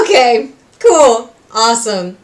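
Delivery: slow, loud, drawn-out mock approval; sarcastic okay. Fan reacting to the finale in slow, mock-cool approval. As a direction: drag it out in fake approval.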